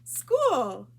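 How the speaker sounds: loud happy call of school; excited shout. Acted. Girl shouting with joy as she reaches school. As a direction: burst out with it, thrilled.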